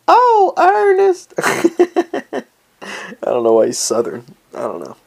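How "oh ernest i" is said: very high, laughing falsetto; laughing squeal. Real, not acted. Cracking up over the biologist's name, high laughing squeal.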